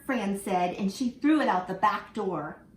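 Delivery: lively storybook reading for little ones; reading to children. Acted. Teacher reading a picture book to her class, lively and expressive. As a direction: read it like story time in class.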